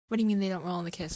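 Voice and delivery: fast, loud indignant shout; mock outrage. Vlogger fakes outrage that the credits roll late, fast and loud. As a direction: blurt it out in fake disbelief.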